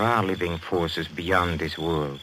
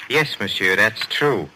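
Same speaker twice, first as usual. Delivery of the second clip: ordinary delivery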